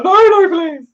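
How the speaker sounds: high, loud mock panic squeal; panicked pleading. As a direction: squeal it like a frightened friend begging. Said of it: "He was like".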